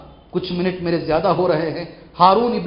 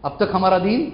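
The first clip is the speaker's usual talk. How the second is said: ordinary delivery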